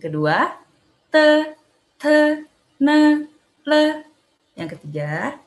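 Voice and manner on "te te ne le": "te, te, ne, le" held high and level; slow separate syllables for repeating; consonant drill. Shown as a demonstration. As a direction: sustain each syllable on one high note. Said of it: "kita baca bareng-bareng".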